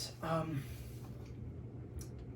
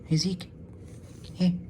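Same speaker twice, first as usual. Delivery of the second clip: short, higher-pitched calls to a dog; calling a dog; 6 semitones higher, 3 dB quieter than usual. Real, not acted. Owner calls guide dog Zeke to look, calling out. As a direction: call the dog by name to get his attention.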